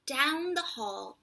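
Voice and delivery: usual speaking voice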